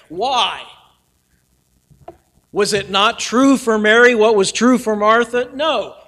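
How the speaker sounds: ordinary talk, nothing marked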